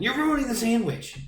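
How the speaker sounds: high-pitched mocking imitation; mock complaining friend. Imitating the friend who gets mad over orders, mock outrage. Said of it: "the guy who gets mad at me".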